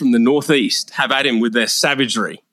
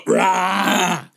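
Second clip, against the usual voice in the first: sudden raspy "rah" roar; mock beastly roar; interrupting roar; 2 semitones higher than usual. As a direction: cut in with a growling roar. Character invades the mic mid-line.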